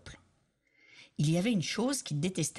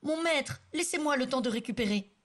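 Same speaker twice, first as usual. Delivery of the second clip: pleading peasant; 8 semitones higher than usual. Acted. Disguised king pleads to master.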